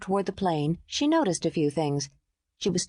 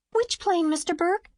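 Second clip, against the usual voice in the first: put-on high eager little girl's voice; a child bursting to get on the plane; excited; 11 semitones higher than usual. A narrator's reading.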